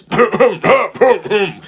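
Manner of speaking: loud pointed throat cough; loud fake cough. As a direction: cough to cut someone off. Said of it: "Gordon coughed loudly".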